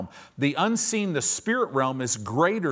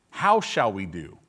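dropped much lower, a flat helpless echo; deflated repeat; 7 semitones lower than usual. Acted. Servant repeats the question to his master, low and deflated. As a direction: let the panic sink into a low, helpless repeat. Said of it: "I think that's hilarious".

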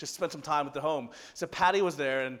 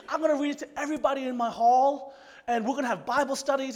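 high-pitched, eager imitation; so excited; 8 semitones higher than usual. Excited woman planning Bible studies for her hall, imitated. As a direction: gush out the plans in a high voice. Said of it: "she was just so excited".

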